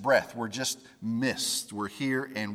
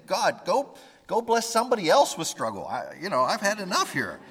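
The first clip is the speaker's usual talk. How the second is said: higher and louder fed-up complaint; exasperated; 6 semitones higher, 4 dB louder than usual. Acted. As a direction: push God away, worn out. Job's exasperated complaint voiced.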